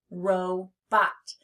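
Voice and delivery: "Robot" in two separate beats, ro and bot; teacher modelling "Robot" by syllables; split into syllables. Shown as a demonstration. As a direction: say "Robot" slowly with a gap after ro. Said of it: "You try it?".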